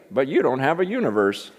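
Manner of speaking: put-on scratchy old preacher's swooping voice; old man's scratchy, lilting voice; J. Vernon McGee. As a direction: imitate a little old preacher delivering his punchline. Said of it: "scratchy voice".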